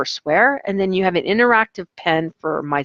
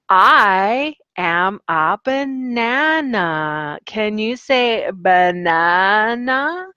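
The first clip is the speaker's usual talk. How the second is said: slow teacher voice; 3 semitones higher, much slower than usual. Shown as a demonstration. Teacher demo with banana prop.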